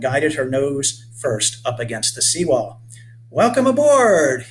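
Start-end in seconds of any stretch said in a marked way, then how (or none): 3.3-4.5 loud, high, drawn-out hail; calling out. A narrator's reading. Boat captain to boarding passengers, calling out. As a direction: sing out a big slow welcome. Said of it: "he called".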